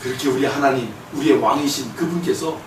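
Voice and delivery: usual speaking voice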